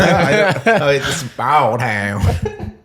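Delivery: very slow, drawled Southern intro; Southern accent. Retold or imitated. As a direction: drag it out like a lazy Southerner. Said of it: "That was not Veggie doing a Southern accent".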